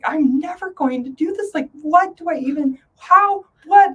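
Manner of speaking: a helpless flustered rise; exasperated panic. Retold or imitated. Retelling staring at ten words, exasperated. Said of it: "I'm like".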